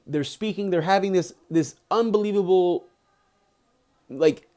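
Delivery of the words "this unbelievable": usual speaking voice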